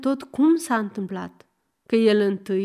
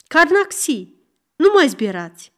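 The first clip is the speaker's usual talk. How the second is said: louder, a stern mock shout for order; 5 semitones higher, 5 dB louder than usual. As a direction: shout them down like a judge. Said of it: "strigă cadiul". (strigăt sever al judecătorului)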